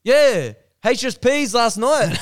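high, loud, drawn-out reminder; excited hollering. Retold or imitated. Smoker's room guy reminding him of last night, excited hollering. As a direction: holler it like an over-keen stranger. Same stranger excitedly insists.

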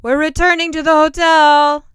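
high, loud call across the room. Acted. Master calls out to the group from somewhere behind, loudly. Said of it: "Master called out".

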